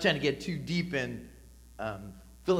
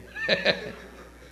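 breathy little laugh; short laugh; 5 semitones higher than usual. Real, not acted. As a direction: laugh at the snowblower joke. Laughs at his own joke.

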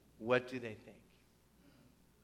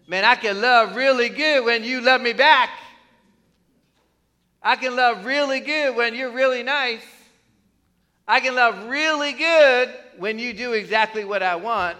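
higher and louder, a smug boast; mock self-satisfied boast; 5 semitones higher, 6 dB louder than usual. Pastor mocking the congregation's conditional love, smug boast. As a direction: play the fair-weather lover bragging.

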